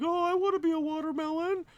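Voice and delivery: high falsetto imitation of his wife; imitating wife. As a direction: squeak it out like his wife wanting a costume. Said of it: "and she was like".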